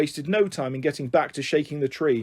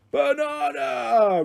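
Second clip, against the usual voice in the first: high, long-drawn roar; hungry gorilla calling out; giant gorilla roar; 11 semitones higher, much slower than usual. A narrator's reading. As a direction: stretch each vowel into a slow animal bellow. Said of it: "roared the gorilla in response".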